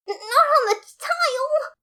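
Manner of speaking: very high, slow wailing protest; high crying shriek; anguished cry. A narrator's reading. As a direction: wail in horror at the floor.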